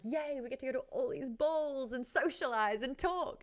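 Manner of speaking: high, bright-eyed mock glee; excited Genya. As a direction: bounce up on Yay like a thrilled Genya. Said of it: "Genya's like".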